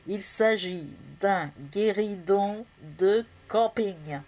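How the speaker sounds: slow, word-by-word announcement; measured, portentous delivery; solemn reveal. Acted. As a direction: unveil it with weighty pauses.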